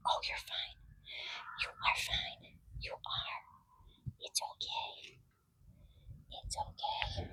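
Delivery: breathy hushed reassurance; calming whisper. Real, not acted. Trainer calming her spooked donkey in a whisper. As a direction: barely voice it, soothing a scared animal.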